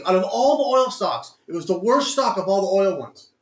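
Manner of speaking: loud, high ranting at the stock; frustrated yelling. Real, not acted. Trader yelling at his losing oil stock, loud and high. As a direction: yell at the screen as it tanks.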